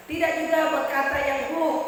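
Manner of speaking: ordinary delivery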